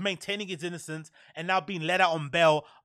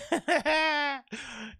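laugh; 10 semitones higher than usual. Real, not acted. Laughing at the actor's situation.

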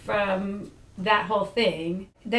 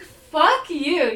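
higher, forceful comeback; retold angry retort; 4 semitones higher, 4 dB louder than usual. Woman answering his date question, retold indignant retort. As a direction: fling it back at him, mildly heated.

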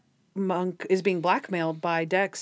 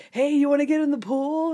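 a lightly voiced upbeat party guest, higher; breezy invite, pitched up a bit; cheery party guy; 6 semitones higher, 4 dB louder than usual. Someone bursts in to party.

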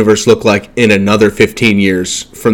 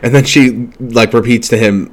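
ordinary delivery

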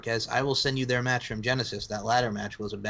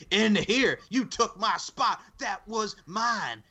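high, slow, drawn-out promo rant; hammed-up high promo voice; mock wrestling promo; 8 semitones higher, much slower than usual. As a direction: imitate an overwrought wrestler, stretching the words. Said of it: "he's cutting that promo".

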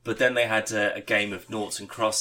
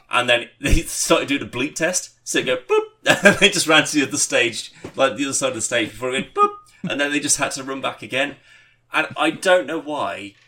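excited retelling; 4 semitones higher, 7 dB louder than usual. Real, not acted. Laughing retelling of bleep test.

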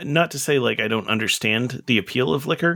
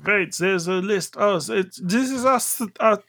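McKenneth voice; 5 semitones higher than usual. Acted. Still in the character voice.

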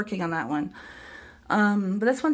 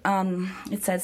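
usual speaking voice

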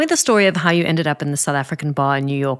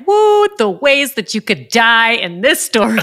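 slow, drawn-out mock horror, pitched higher and louder; mock alarm; 6 semitones higher, 5 dB louder, slower than usual. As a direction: play up being spooked by the danger. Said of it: "I was like".

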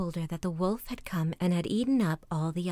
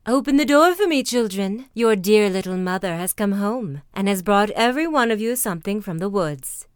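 loud, coaxing call of a disguised wolf; wolf posing as mother; 8 dB louder than usual. Acted.

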